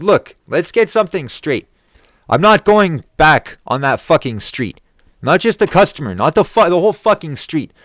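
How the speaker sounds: loud, high, worked-up rant; angry ultimatum. Retold or imitated. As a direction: lay down the law to the boss, furious. Retold ultimatum to his boss.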